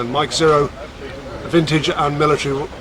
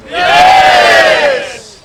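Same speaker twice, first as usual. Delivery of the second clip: loud high whoop of yes; excited cheer; 9 dB louder than usual. Real, not acted. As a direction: rouse the crowd with a big yes. Crowd cheer prompt at event.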